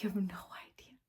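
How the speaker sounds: soft unvoiced whisper into an ear; tender secret whisper. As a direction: lean in and whisper it gently. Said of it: "I wish I could just whisper into her ears".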